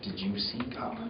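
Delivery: hushed, awed whisper; breathy mock awe. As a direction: ask it in a secretive hush. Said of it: "the question I get all the time is".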